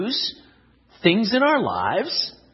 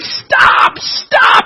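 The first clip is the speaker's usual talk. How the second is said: loud, high desperate yell; acted pleading shriek; crying out; 9 dB louder than usual. A narrator's reading. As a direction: yell as if sickened by the fumes. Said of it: "and I cried".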